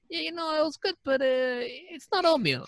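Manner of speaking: high teasing doorman impression; mock doorman. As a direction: drag out the picky doorman's complaint in a high squeak. Doorman voiced again.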